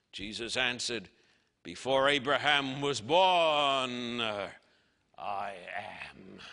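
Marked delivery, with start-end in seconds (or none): 1.6-6.4 slow, weighty, dropping to a low hush on "I am"; solemn slow declaration. Acted. Preacher quoting Jesus to the crowd, solemn hush. Jesus's claim quoted in sermon.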